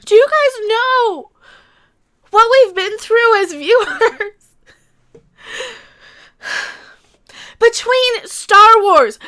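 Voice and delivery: slow, emphatic, wounded appeal; emotional. Real, not acted. Fan telling viewers what they have been through, emotional outburst. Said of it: "Sorry, I'm getting emotional".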